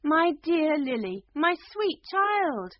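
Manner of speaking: high, loud cry of a frantic mother; distraught queen's high call. A narrator's reading. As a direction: wail out to your child, drawing it out.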